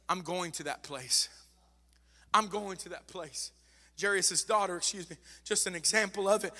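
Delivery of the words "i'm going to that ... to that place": low, soft, unhurried reply; quiet resolve. Acted. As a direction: answer the plea softly and firmly. Jesus' firm answer to plea.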